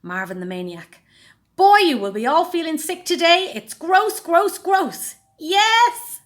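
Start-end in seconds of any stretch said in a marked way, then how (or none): 1.5-5.1 loud, high, wild showman voice; a high, loud manic host yelling gross; manic TV presenter. A narrator's reading. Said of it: "screeched the presenter". 5.4-6.2 gleeful yes. A narrator's reading. Henry delighted by gross show.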